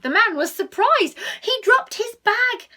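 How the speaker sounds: high and loud with shock; surprised exclamation. A narrator's reading. The burglar startled by the meow, loud surprised telling. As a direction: jolt up in surprise.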